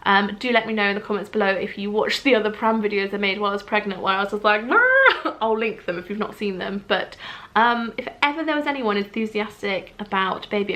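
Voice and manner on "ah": high, loud mock scream of glee; excited squeal. Imitating herself in old pram videos, excited squeal. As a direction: squeal like an overexcited fan.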